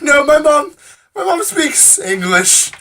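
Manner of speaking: very high, loud, exasperated squeal; retold incredulous protest. Passenger corrects woman about mom's language, incredulous. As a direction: protest the silly assumption in a high, drawn-out voice.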